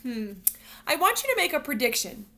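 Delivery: usual speaking voice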